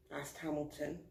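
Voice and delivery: plain reading voice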